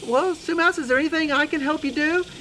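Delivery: high little mouse voice; obliging cartoon mouse; helpful Mister Mouse. Mister Mouse offers help.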